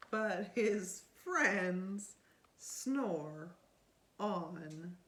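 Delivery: put-on lively read-aloud to a child; storybook reading. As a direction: read it like bedtime story time. Reading picture book aloud.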